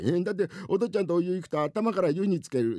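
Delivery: put-on high child voice; complaining little boy. Son explains his dread to father, complaining. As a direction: protest like an aggrieved child.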